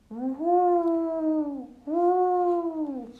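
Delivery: high, loud hooting call; loud owl hoot. A narrator's reading. An owl's hoot interrupts the animals' talk, loud and high. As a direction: hoot it out long like an owl in the night. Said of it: "Ein lautes Heulen".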